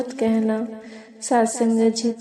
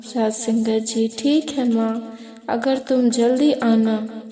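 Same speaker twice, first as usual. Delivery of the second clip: ordinary talk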